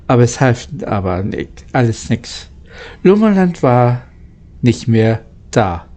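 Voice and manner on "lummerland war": ordinary delivery, nothing marked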